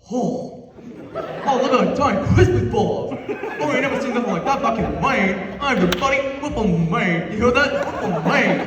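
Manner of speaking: put-on loud, laughing yokel accent from Maine. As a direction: ham up a backwoods Maine dad gawking at the city. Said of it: "my dad's in the background, just, like, going".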